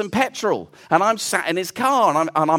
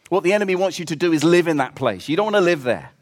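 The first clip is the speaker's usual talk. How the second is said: normal talking voice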